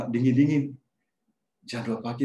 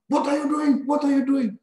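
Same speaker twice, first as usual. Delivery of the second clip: much higher, louder furious repeated shout; angry yell; 3 dB louder than usual. Retold or imitated. Locked-in coworker yelling through the glass door, furious. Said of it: "Terus dia marah".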